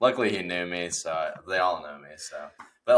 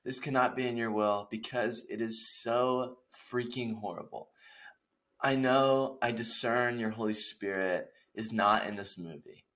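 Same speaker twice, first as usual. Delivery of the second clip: soft, raised-pitch mock prayer; hushed, earnest-sounding comic supplication; desperate prayer; 4 semitones higher, 6 dB quieter than usual. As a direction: plead softly with God, mock-solemn. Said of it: "I'm on my knees".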